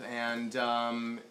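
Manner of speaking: ordinary delivery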